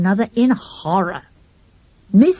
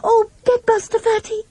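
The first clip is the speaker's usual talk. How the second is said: high, pleading cry for help; frightened plea. A narrator's reading. Little girl begs Fatty to stop the dog fight, high and frightened. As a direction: beg Fatty to grab the dog.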